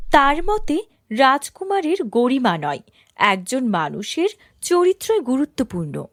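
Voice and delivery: usual speaking voice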